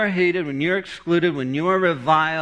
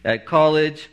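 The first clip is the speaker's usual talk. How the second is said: ordinary talk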